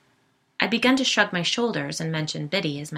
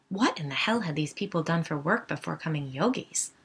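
ordinary delivery, nothing marked

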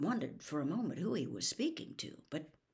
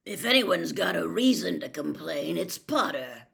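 louder, growled retort; 8 dB louder than usual. A narrator's reading. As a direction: growl it pointedly. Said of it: "growled Moody".

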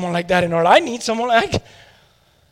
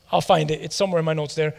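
ordinary delivery

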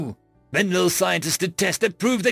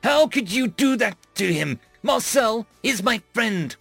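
high, impassioned reproach; furious accusation; 6 semitones higher than usual. A narrator's reading. Alien confronts captain over tortured friend, furious. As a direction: accuse in indignant outrage.

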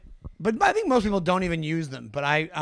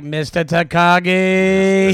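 very slow, drawn-out menacing name; Die Hard villain; 7 dB louder, much slower than usual. Retold or imitated. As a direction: savour the name like Hans Gruber. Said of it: "Jeff's like".

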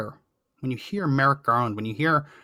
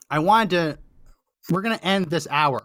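normal talking voice

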